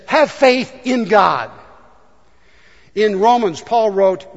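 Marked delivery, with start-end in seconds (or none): 0.1-1.5 slow, louder proclamation; slow, raised declaration of faith; preaching. Retold or imitated. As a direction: proclaim it slowly. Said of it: "He said".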